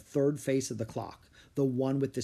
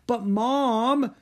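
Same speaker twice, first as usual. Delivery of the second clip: high, loud drawn-out objection; protesting; 10 semitones higher, 6 dB louder than usual. A narrator's reading. Son as mother ends the discussion, protesting. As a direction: whine it in protest. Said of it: "Drake protested".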